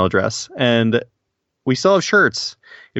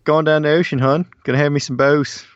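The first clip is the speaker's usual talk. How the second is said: a hammed-up Baltimore "hon" accent, lightly done; 4 semitones higher than usual. [name] teasing his co-host about Maryland talk, in a mock Baltimore accent. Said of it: "You do that a little better than I do".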